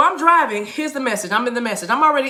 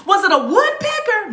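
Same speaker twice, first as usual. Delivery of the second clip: high-pitched playful question; teasing guess; 8 semitones higher than usual. Real, not acted. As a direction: pipe up with a silly bird guess. Mock guessing the bird again.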